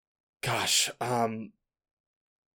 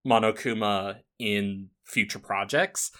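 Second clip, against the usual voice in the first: ordinary delivery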